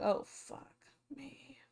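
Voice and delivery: breathy slow whisper of dread; whispered curse. Retold or imitated. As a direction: whisper it, bracing for trouble. Imagined reaction to danger.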